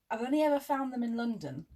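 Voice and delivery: ordinary talk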